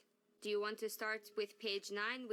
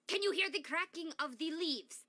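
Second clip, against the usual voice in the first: louder and higher, with more push on "you"; more dramatic; 5 semitones higher, 5 dB louder than usual. Shown as a demonstration. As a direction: lift it up and push the line out. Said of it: "That was much better".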